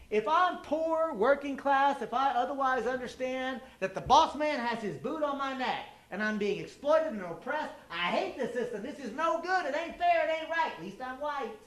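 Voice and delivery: high-pitched, aggrieved working-class complainer. Acted. Speaker acts out whiteness for the audience, aggrieved worker. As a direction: act out a put-upon worker griping about the boss. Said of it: "I think whiteness goes like this".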